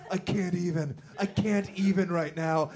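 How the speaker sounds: ordinary talk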